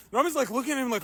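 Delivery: ordinary talk, nothing marked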